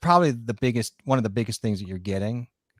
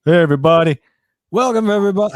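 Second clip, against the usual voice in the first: booming Viking host, much higher and louder; John's Viking voice; 7 semitones higher, 10 dB louder, faster than usual. Retold or imitated. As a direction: belt a big Viking welcome. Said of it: "I'm gonna do my John voice".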